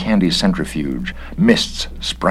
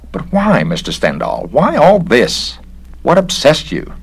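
high, pleading questions; a bewildered bureaucrat begging to know; baffled inspector; 11 semitones higher, 4 dB louder than usual. Acted. Inspector begs Stendhal to explain, high and baffled.

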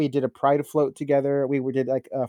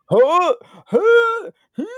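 loud high retching gasps; gagging; 7 dB louder, much slower than usual. Retold or imitated. Brushing teeth in the morning, a loud high gag. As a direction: act out gagging on a toothbrush. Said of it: "it's like".

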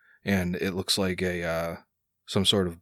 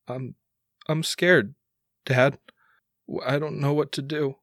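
higher, halting scared son; starting to crack; 5 semitones higher than usual. Acted. Son admits to his dad he's scared, cracking. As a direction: confess fear in small pieces, pitched up. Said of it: "he starts to, to crack as well".

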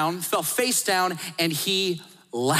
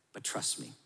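hushed reassurance; 11 semitones lower, 15 dB quieter than usual. Pretend. God whispers to Abram to trust him, hushed. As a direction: breathe it as a soft unvoiced whisper.

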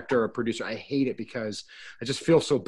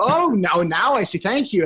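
high, mock-enlightened thanks; actor pretending insight; 6 semitones higher, 4 dB louder than usual. Actor pretending a pointless take helped, high and mock-grateful. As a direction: act like it all makes sense now. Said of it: "you go".

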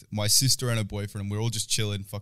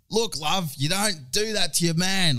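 higher mock scolding from an older Aussie mum; loud mum; 8 semitones higher, slower than usual. Pub mum telling her daughter off, higher and mock loud. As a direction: ham up a pub mum siding with the boyfriend. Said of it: "going, like, so loud".

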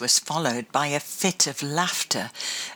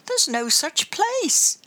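Grandma voice; 12 semitones higher, slower than usual. Acted. Grandma's voice, slow and firm.

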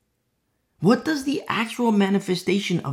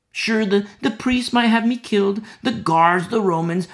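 louder, higher fearless defiance; Mary defiant, raised voice; defiant cry; 4 semitones higher, 5 dB louder than usual. Acted. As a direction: fling it out, ready to die. Mary defiant against danger.

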